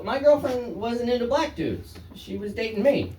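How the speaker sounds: ordinary delivery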